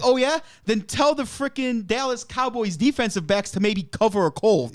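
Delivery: mock retort. Retorting to angry critics.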